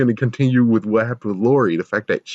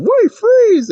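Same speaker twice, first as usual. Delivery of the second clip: very high, loud yelled command; mock guard shout; 9 dB louder than usual. Guard yells at a monster, loud and high. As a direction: bark it like a panicked guard. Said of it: "the security guard".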